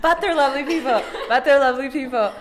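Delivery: high-pitched, laughing repeat; laughing echo. Real, not acted. Co-host echoing guest's disclaimer about Quebecois, laughing high.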